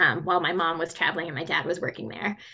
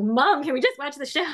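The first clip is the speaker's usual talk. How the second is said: put-on kids' voice; 7 semitones higher than usual. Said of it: "they're like".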